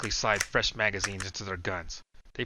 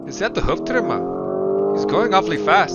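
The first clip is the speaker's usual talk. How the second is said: puzzled father; 8 semitones higher, 10 dB louder than usual. A narrator's reading. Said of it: "says the older man".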